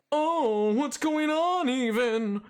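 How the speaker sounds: lilting cartoon impression, falling on the last word; Snagglepuss. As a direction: put on a hammy cartoon drawl. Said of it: "And he just talked like that".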